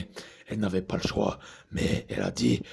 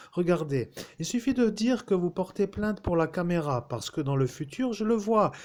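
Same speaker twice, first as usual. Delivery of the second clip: raised, insistent officer voice; a slightly higher-pitched commanding captain; captain's voice; 8 semitones higher than usual. Acted. Voices Captain Fabin at length.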